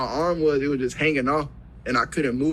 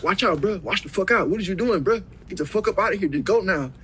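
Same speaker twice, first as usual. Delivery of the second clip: higher-pitched, urgent mock confrontation; retold angry warning; 6 semitones higher than usual. Father telling intruder to get out, retold angry warning. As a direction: re-enact ordering an intruder out.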